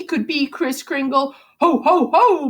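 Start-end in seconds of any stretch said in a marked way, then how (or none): 1.6-2.5 jolly Santa laugh. Acted. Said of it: "Murray laughed".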